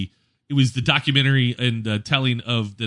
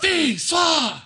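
high-pitched chanting shout; imitated screaming crowd. French fans screaming at the match, high imitated shout. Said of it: "the French are out screaming".

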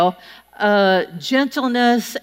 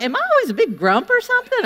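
high indignant squeak; 10 semitones higher, faster than usual. Retold or imitated. Indignant retort to coworkers.

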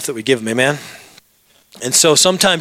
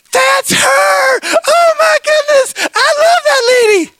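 very high, loud squealing shout; hammed-up ecstatic outburst; way too excited; 6 dB louder, slower than usual. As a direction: shriek it like an overjoyed superfan. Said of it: "way too excited for this moment".

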